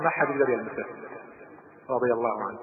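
usual speaking voice

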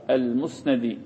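المسندي pronounced al-musnadi, open vowel on the nun; fatha on the nun. Shown as a demonstration. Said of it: "بفتح النون المشددة".

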